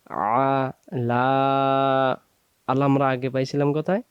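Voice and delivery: ordinary delivery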